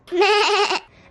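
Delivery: loud, high-pitched imitated sheep bleat; imitated bleating sound; weird sheep bleat. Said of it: "making these weird sounds, something like these".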